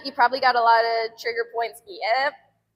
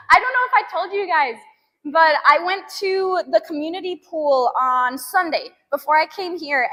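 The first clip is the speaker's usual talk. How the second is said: ordinary talk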